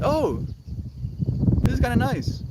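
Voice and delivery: discover it's nice after all; pleasantly surprised. Retold or imitated. Mel finding the light nice, high pleasant surprise. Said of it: "And then in the light".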